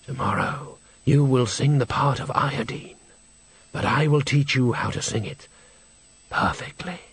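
pause, then soften and draw out the last word; mysterious teacher's voice. Acted. Mysterious mirror teacher instructs Agnes.

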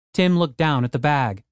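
normal talking voice

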